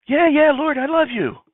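high, loud, exasperated assurance; impatient insistence. Acted. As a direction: insist as if pestered by the question. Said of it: "What's your problem?".

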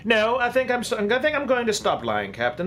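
high-pitched, exasperated retraction; exasperated confession. Acted. Passenger who stops lying to captain, exasperated.

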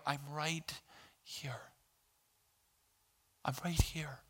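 very soft, hushed reassurance; gentle whisper. Acted. Pastor voicing God's answer to mourners, soft whisper. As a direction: whisper the comfort like God leaning close.

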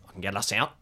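ordinary talk, nothing marked

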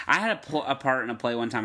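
normal talking voice

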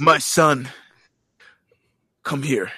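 lightly voiced proud father calling his son; a hint of Vegeta, calling his son close; Vegeta impression. Retelling the cartoon, doing Vegeta to his son, lightly. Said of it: "You sound just like him".

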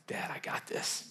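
soft whisper, lightly voiced big sister; whispered daughter. Daughter tells dad she'll handle it, whispered. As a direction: whisper it like a confident little girl.

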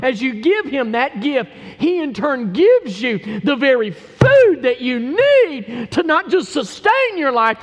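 fervent pulpit proclamation; full-force preaching. Real, not acted. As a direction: build to a shouted appeal to the congregation.

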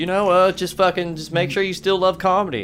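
reservation gambler voice. Pretend. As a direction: play the old guy gambling at the reservation casino. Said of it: "You sounded like the guy gambling at the reservation".

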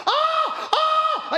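high mock wail of professional mourners. Preacher imitating hired mourners wailing, mock wail. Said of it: "people in there going".

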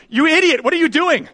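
high, loud mock outrage; indignant passerby. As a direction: scold like an appalled passerby. Acted passerby scolding the drivers.

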